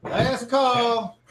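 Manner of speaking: high, loud last call shouted to the room; barkeep calling out. Acted. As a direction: call it out over the bar noise. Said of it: "barkeep's like".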